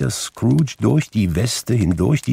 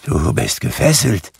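trembling. A narrator's reading. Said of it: "sagte Scrooge zitternd".

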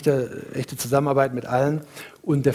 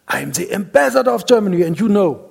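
indignant insistence; 4 semitones higher, 4 dB louder than usual. Retold or imitated. Ambassador insists on his status.

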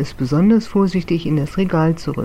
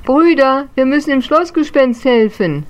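high, loud rallying call; rally your brothers to help; 9 semitones higher, 5 dB louder than usual. Acted. Little book ghost calling the others to rescue the castle ghost. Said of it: "sah das und rief".

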